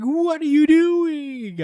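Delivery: put-on very high, slow, shrieking tsundere girl; tsundere outburst. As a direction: drag it out in an exaggerated anime shriek. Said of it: "tsundere mode".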